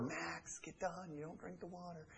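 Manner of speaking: very soft, hushed command; whispering to the dog. Shown as a demonstration. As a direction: barely breathe it to the dog. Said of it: "But if I whisper".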